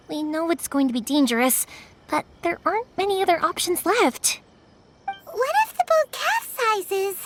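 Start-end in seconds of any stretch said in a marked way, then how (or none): 5.1-7.3 slow, high, worried whine; fearful. Acted. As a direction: fret slowly, voice up high. Frightened about drowning.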